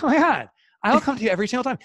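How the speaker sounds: high, loud mock gushing; thrilled client. Imagined client raving to the psychic who flattered them, thrilled. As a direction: gush like an impressed customer.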